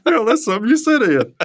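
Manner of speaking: high, loud, cracking up; laughing. Real, not acted. Teacher agreeing with co-host's chair joke, laughing hard. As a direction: echo the joke through laughter.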